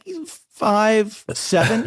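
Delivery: high, drawn-out tentative answer; hesitant guess. Retold or imitated. Nervous guess at actor's height.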